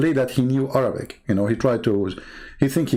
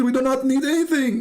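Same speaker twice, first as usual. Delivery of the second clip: mocking quote; 11 semitones higher than usual. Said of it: "He said".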